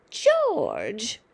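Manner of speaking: harsh, whispery distant call; screechy call from another room. Acted. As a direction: call him from the next room. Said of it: "came the screechy voice from the next room".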